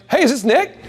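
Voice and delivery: high, loose hello to a buddy; casual friend on the phone; friendly phone greeting. Shown as a demonstration. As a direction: sound like you already know him. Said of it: "But I'm like".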